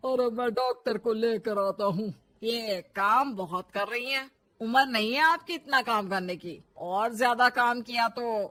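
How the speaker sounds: lightly voiced older husband; concerned father-in-law. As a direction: fuss with a touch of firmness over his tired wife.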